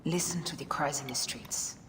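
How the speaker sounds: soft, tense whisper; bitter whisper. Acted. Betrayed wife to Cleopatra, bitter whisper. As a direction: hiss it at her quietly.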